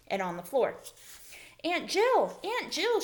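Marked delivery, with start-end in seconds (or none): 1.6-3.0 high, loud cry for her aunt; shrieking. A narrator's reading. Lana calling to her aunt, shrieking high. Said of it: "she shrieked".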